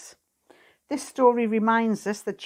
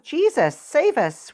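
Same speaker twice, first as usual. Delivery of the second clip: raised, higher-pitched cry for help; urgent plea, pitched up; calling out; 6 semitones higher, 5 dB louder than usual. Acted. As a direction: call out for rescue, louder and higher. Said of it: "They call out".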